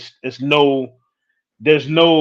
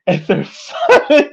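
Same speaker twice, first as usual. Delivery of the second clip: slow, higher and louder through laughter; amused, voice lifting as the laugh breaks in; 8 semitones higher, 6 dB louder, slower than usual. Real, not acted. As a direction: laugh your way through the words. Laughs at own remark about remarrying.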